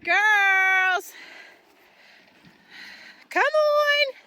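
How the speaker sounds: very high, slow, drawn-out call; calling the cows. Real, not acted. Farmer calling her cows from far off, very high long call. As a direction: sing it high across the field.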